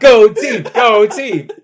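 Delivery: loud cheer, go team; cheering shout. Pretend. Mock cheering during sex.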